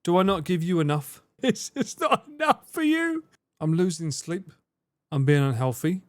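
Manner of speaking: normal talking voice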